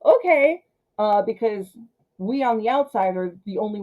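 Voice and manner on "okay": much higher, louder mock jump-scare yelp; startled yelp then a breathy okay; startled whoop. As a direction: yelp as if the scare just got you. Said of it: "a decent little".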